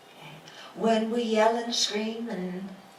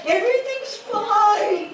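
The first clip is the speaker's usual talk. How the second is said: high, loud, sulky brush-off; sulky fine; 13 semitones higher, 9 dB louder than usual. Retold or imitated. Person sulking at someone who asks, high and loud. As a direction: snap it out, clearly not fine, falling. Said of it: "And we go".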